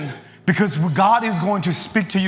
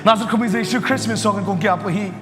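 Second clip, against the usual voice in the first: a touch of fervent pastor's prayer, pitched up; 5 semitones higher than usual. Acted. Pastor praying aloud over the church, fervent. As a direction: lead the room in earnest prayer.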